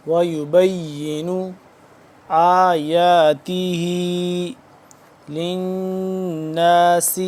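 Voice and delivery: slow melodic Quranic chant; Quran recitation. Shown as a demonstration. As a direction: recite it like tilawat with long vowels. Recites verse. (قرآن کی تلاوت کا انداز)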